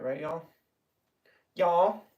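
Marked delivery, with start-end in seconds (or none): 1.5-2.0 mock Southern drawl. Shown as a demonstration. Joking to viewers about fake accents, hammed-up Southern accent. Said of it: "I'm not one of those people who put on fake accents".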